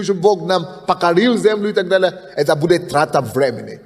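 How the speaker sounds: ordinary talk, nothing marked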